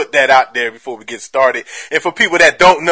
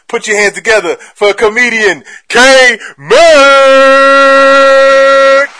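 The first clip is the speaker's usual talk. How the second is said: building to a loud, high shout; hype announcer; 6 semitones higher, 7 dB louder than usual. Real, not acted. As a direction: bring the guest on like a stage emcee, stretching the name. Hype introduction of the guest.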